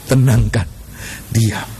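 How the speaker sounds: low, measured, spaced-out phrases; calm. Real, not acted. Preacher showing calm prayer after panic, low and calm. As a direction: settle the voice down, pausing between phrases.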